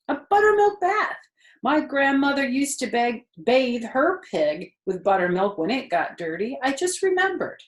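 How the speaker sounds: high, bright-eyed wife with a sudden idea; eager farm wife. A narrator's reading. Wife telling husband about buttermilk, high and eager. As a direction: pitch her up high and eager. Mrs Zuckerman voiced in story.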